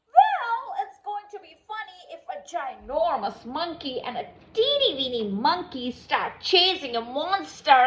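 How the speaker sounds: ordinary delivery, nothing marked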